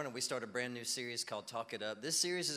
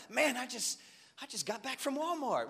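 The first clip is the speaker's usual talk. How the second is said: high, loud mock excitement; so excited; 11 semitones higher, 4 dB louder than usual. Churchgoer telling pastor about an errand, high hammed-up excitement. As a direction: gush over trivial news as if momentous. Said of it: "run up to me so excited".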